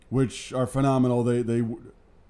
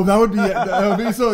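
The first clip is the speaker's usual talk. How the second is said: ordinary talk, nothing marked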